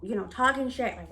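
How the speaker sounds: ordinary delivery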